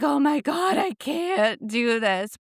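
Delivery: mock despair; exasperated. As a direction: at the end of her rope. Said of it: "I'm just like".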